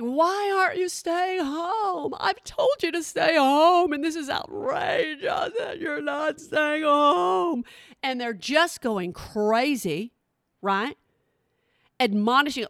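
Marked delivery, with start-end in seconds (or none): 0.0-7.6 high, slow whiny scolding; mocking celebrity. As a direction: mimic a preachy star wailing at fans. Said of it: "We're like".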